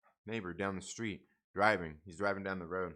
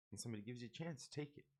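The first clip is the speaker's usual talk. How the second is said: much softer, almost under the breath; soft repeat; 15 dB quieter, faster than usual. Shown as a demonstration. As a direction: let the advice sink in quietly.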